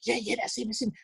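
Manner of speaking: hushed retold chatter; imitated nattering. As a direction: imitate girls nattering behind someone's back. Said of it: "I could hear them all nattering away".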